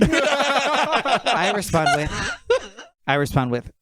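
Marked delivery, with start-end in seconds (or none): 0.0-2.4 words broken by laughter; giggling through it; laughing. Real, not acted. Reading a funny text exchange.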